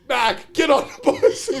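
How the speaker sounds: high, loud, slow order; drawn-out yell. Retold or imitated. Coach sending Mac onto the bus, slow loud yell. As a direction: stretch it out like a furious coach. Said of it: "he's like".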